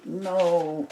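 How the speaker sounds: frustrated no. Real, not acted. Frustrated at creased paper.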